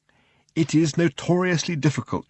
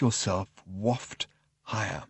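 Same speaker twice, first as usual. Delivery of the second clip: soft, slow and lower; gentle coaxing; 4 semitones lower, 11 dB quieter, slower than usual. A narrator's reading. Narrator urging a novice flyer to let go and rise, gently coaxing. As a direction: let the words drift out slowly and softly.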